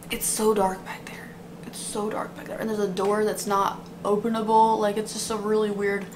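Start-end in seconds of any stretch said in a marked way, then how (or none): 0.1-2.6 hushed unvoiced whisper. Real, not acted. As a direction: whisper it into the dark. Whispers about dark back room.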